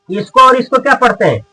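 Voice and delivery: ordinary talk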